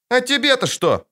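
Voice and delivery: put-on high, sulky little-girl retort; snuffling child. A narrator's reading. Girl rebuffs Geralt's question, snuffling. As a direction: pipe it back through a sniffle. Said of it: "хлюпнула она носом".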